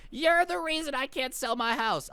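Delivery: high-pitched mock screech of a scolding neighbor; mock scream. Old neighbor screaming at an eleven-year-old, mock scream. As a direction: imitate an old woman screaming, without much added volume. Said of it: "getting screamed at by some old woman".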